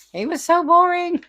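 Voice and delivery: high, loud exasperation; loud groan of exasperation; exasperated. Real, not acted. Complaining about a boring teacher.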